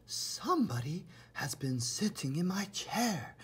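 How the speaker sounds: whispered, mother bear role; hushed whisper of the middle-sized bear. A narrator's reading. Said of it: "in her middle-sized voice".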